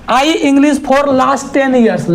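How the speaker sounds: very slow test-sentence reading. Shown as a demonstration. Teacher reads the blank sentence slowly to the class. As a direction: drag each word out.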